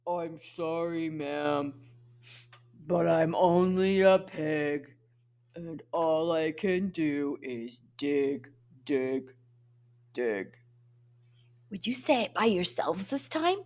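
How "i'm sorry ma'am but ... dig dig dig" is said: low, slow, apologetic big pig. Acted. Big pig apologising to ma'am, low voice. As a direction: answer down low, sinking on each dig. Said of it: "he uses his low voice".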